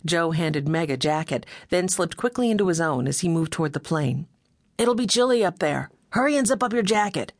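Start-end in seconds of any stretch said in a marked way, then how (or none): 4.8-7.3 calling out. A narrator's reading. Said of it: "Joe called to Meg".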